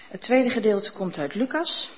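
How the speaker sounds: ordinary talk, nothing marked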